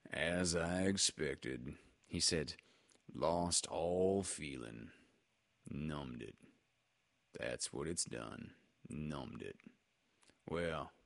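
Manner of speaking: low, slow, soft mournful donkey; gloomy Eeyore. A narrator's reading. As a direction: complain without wanting to complain.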